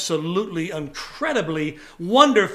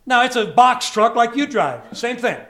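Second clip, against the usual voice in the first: ordinary talk, nothing marked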